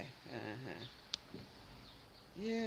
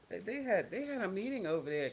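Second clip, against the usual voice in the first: ordinary delivery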